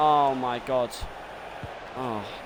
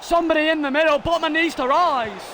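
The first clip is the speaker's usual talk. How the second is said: high, anxious instruction to players; raised-pitch alarmed commentary; urgent calling out; 9 semitones higher, 4 dB louder than usual. Real, not acted. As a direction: call out urgently to the defence. Calling out to defenders.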